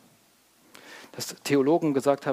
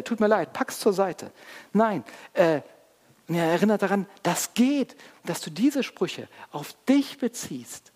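high, loud, urgent sermon delivery; impassioned preaching; 7 semitones higher, 5 dB louder than usual. Real, not acted. Preacher confronting listeners about Israel's promises, impassioned. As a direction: preach it with raised voice and force. Preacher keeps up full force.

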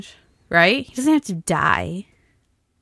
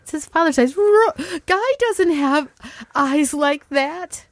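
high, stretched-out mock disbelief; acted mother's protest; 9 semitones higher, slower than usual. Mother told of his father's eyes, mock-horrified protest. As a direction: imitate a distressed mother, pitched way up. Acting out exchange over baby's eyes.

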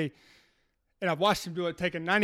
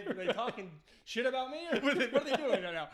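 laughing; 6 semitones higher, 6 dB quieter than usual. Retold or imitated. Retold suspicious thought, laughing.

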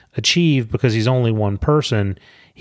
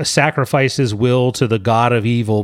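ordinary delivery

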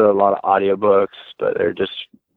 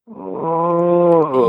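stifled scream; 7 semitones higher than usual. Retold or imitated. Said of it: "you just kinda go".

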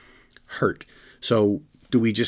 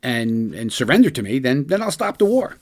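lightly mocking negotiator, pitched up; raised, mock-bargaining delivery; mock Putin demand; 4 semitones higher, 5 dB louder than usual. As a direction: voice a tyrant's terms in a higher, louder mock tone. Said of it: "his negotiating point".

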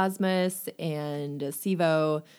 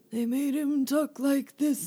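high, creaky imitation of an old man; 10 semitones higher than usual. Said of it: "the reader gave Lind Hall an old man voice".

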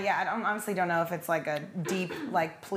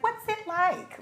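high-pitched imitation of clueless curiosity; mock naive question; 11 semitones higher, slower than usual. Americans asking broad questions about other cultures, mock naive.

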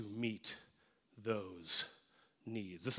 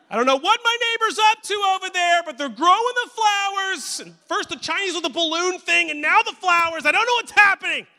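much higher, louder fretful squeak; paranoid neighbor; 14 dB louder than usual. Acted. Pastor mocks a paranoid neighbor's post, high and panicky. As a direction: play the panicky suburban neighbor.

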